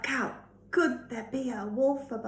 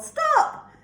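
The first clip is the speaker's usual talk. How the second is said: high, loud "Stop!"; louder voice; 8 dB louder than usual. Shown as a demonstration. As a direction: shout "Stop!" sharply high. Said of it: "read it in a louder voice or a surprised".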